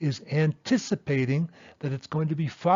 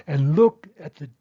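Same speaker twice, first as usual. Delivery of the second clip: normal talking voice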